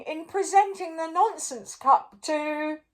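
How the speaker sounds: high, loud pompous king; king proclaiming the winner; royal announcement. A narrator's reading. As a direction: declare it grandly. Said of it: "announced the king".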